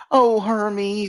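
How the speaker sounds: mock lovesick swoon, pitched high; lovelorn Lily. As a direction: imitate a shaky, lovelorn wife cooing in a high voice. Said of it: "that shaky, lovelorn voice that she has".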